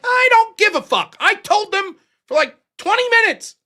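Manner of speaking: high, loud defiant shout; angry retort. Retold or imitated. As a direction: fire back at the friend, still furious.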